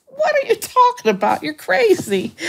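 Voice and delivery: high, squeaky mock old caller protesting; incredulous old man.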